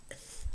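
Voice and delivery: "th" as breath alone between the teeth; voiceless th. Shown as a demonstration. Teacher naming the sound for learners, voiceless "th". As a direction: isolate a soft, voiceless "th". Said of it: "voiceless interdental fricative".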